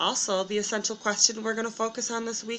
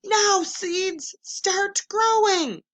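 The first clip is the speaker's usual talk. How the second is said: high, loud, slow shout at the seeds; 10 semitones higher, 6 dB louder, slower than usual. Acted. Toad shouts at his seeds to grow. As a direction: yell it down into the dirt.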